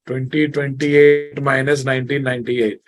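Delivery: ordinary talk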